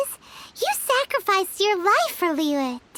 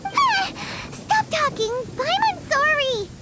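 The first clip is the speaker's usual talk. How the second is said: much higher, hurried cry to make it stop; panicked squeal; 8 semitones higher than usual. Acted. Paimon scared by the stern lecture, panicked high squeal. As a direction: squeal in alarm, then apologize quickly.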